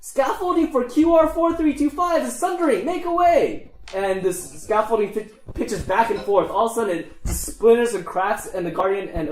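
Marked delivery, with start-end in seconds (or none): 0.0-3.7 very high gnome warning cry; urgent, high-pitched gnome announcement; alarmed gnome. Acted. Gnome warns as scaffolding collapses.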